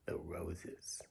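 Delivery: hushed whisper for effect; storybook whisper. A narrator's reading. Telling children Harry's secret dislike, in a whisper.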